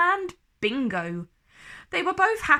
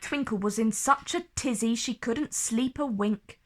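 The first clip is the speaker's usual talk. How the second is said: plain reading voice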